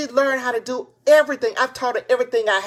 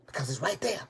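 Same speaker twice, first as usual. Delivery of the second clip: whisper; 8 semitones lower, 9 dB quieter, faster than usual. Real, not acted. Whispered encouragement after laughing.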